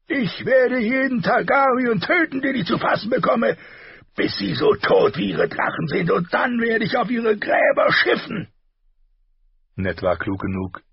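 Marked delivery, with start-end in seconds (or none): 0.1-8.5 high, loud raging threat; furious shouting. A narrator's reading. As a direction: shout the vow of vengeance. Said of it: "deutete mit wütendem Finger auf Ned".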